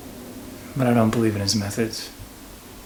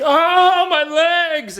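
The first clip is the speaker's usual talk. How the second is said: high, shrill-pitched mock scream of pain; hammed-up falsetto wail; mock screaming henchman; 5 dB louder, much slower than usual. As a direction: squeal it like a hurt thug. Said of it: "screaming things like".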